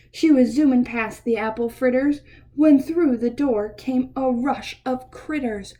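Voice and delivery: ordinary delivery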